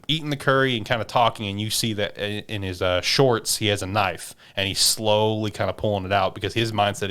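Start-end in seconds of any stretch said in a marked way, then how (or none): none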